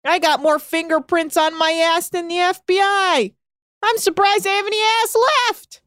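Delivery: high, building comic indignation; mock exasperated outburst; imitated captain. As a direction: act out the harassed man, rising in pitch. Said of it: "And he's like".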